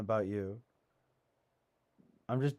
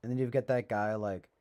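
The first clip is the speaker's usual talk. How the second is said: ordinary talk, nothing marked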